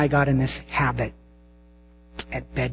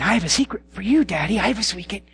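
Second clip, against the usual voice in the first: put-on higher, quick child's eager voice; little girl voice; 6 semitones higher, much faster than usual. Daughter to daddy, eager and higher-voiced. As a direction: rush it like an eager toddler. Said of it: "And then she would say".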